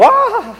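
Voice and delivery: sudden high shriek of terror; terrified scream. Retold or imitated. People who met angels cry out, shrieking in terror. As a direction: shriek as if an angel just appeared. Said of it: "people who actually encountered angels, go".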